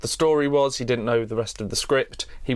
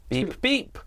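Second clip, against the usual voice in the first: short beeps, second jumping much higher; robot beeping; 7 semitones higher than usual. Acted. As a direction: imitate a droid's rising beeps. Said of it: "just her going".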